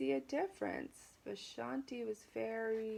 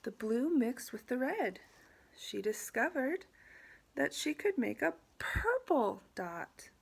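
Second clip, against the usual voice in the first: higher, lilting storybook narration; picture-book reading; 4 semitones higher, 6 dB louder than usual. A narrator's reading. Teacher reading to students about mixing colors, animated storybook narration. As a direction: share the discovery with young listeners.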